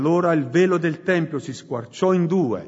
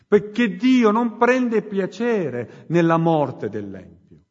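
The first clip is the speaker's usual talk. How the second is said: fiery preaching; 3 semitones higher than usual. Acted. Preacher urging the congregation to repent, full-force preaching. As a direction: preach it out to the whole church.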